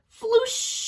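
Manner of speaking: flush sound. A narrator's reading. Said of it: "it says".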